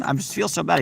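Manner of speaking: ordinary talk, nothing marked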